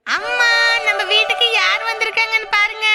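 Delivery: singsong call into the house; excited calling out. Acted. Calls mother on arriving with baby.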